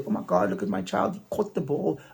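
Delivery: lightly mocking proud-parent coo; mimicked proud parent. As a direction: imitate a thrilled parent showing off. Mimics proud parents cheering their child.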